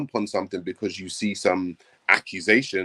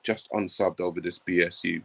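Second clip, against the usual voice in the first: ordinary talk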